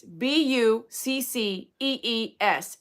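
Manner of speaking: slow, letter by letter; spelling out. Real, not acted. Spelling the store name for Joey, slow letters. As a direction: spell it out slowly.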